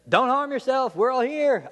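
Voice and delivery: high-pitched urgent call; acted shout. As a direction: call out to stop someone, high and pressing. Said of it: "Paul shouts in a loud voice".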